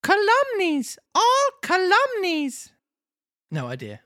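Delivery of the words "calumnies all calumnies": very high, drawn-out protest; indignant puppet. Acted. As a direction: cry slander as a squeaky little marionette.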